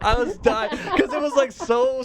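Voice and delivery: high, words squeezed through laughter; helpless giggling, slowed by laughs; real laughing. Real, not acted. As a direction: crack up while telling it. Teller cracks up at own story.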